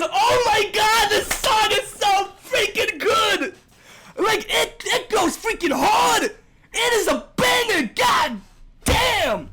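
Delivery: high-pitched, drawn-out fanboy raving; real excitement. Real, not acted. Fanboy raving to viewers that the song is a banger, excited. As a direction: shout it out, savoring each word. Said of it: "I am so excited".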